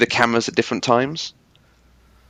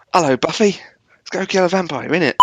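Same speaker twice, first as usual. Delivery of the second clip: hammed-up British accent, pitched high; Giles; 8 semitones higher than usual. Co-host imagines a different Giles to Buffy, exaggerated British accent. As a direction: put on a silly high cockney-style British voice. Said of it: "Imagine if Giles had been like that".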